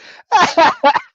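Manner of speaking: high, loud burst of laughter; big laugh. Real, not acted. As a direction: crack up at the absurd scene. Laughs at Patrick's move.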